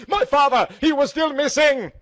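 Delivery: high, loud panicked cry; small scream. Acted. Heroine jolts awake, remembering her missing father: high, loud scream. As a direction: scream it out in sudden alarm. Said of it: "let out a small scream".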